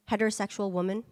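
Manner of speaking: usual speaking voice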